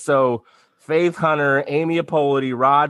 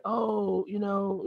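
much higher, much softer hesitant little voice; mock meek hedge; 8 semitones higher, 11 dB quieter, much slower than usual. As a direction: sound small and unsure, as if you have nothing to offer. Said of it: "not just be like".

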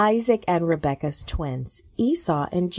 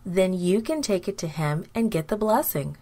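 usual speaking voice

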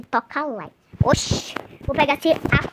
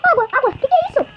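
much higher squeaky cartoon panic; panicked burnt character; 13 semitones higher, much faster than usual. Girl voicing her game character after eating pepper, squeaky high panic. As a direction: squeal it like a cartoon kid who ate pepper.

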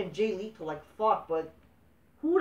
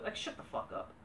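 whispered under the breath; muttered aside; 16 dB quieter than usual. Real, not acted. Fed up with fan praise, whispered aside. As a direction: hiss it to yourself. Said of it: "rants at smarks".

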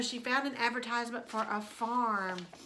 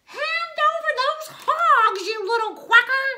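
very high, loud outraged screech; screeching witch; 8 dB louder than usual. A narrator's reading. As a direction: screech it in the duck's face. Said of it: "Gretch screeched into its bill".

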